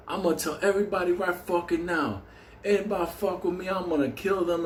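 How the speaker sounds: high, hammed-up tough guy bragging; tough-guy threat. New inmate threatening the whole cell, hammed-up tough-guy voice. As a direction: play a movie-style hard man. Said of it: "He tells everybody".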